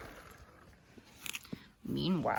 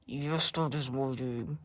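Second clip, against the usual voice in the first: low slow voice; 5 semitones lower, 8 dB quieter, slower than usual. Acted.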